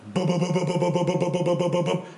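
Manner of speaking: rapid string of mouthed booms; mock explosions. Charges going off at the Silver Dome, mouthed booms. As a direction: pop off the charges one by one. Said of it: "it goes".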